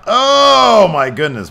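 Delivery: sudden high, loud gasp of excitement; excited outburst. Real, not acted. Commentator watching a top-rope move, sudden excited cry. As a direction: commentator caught off guard by a move, excited shout. Big move in wrestling game.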